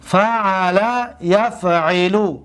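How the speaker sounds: drawn-out Arabic verb form; یفعلو spelled out slowly, each vowel held; slow model word. Shown as a demonstration. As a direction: say it slowly so students hear the vowel. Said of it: "دقت بتید".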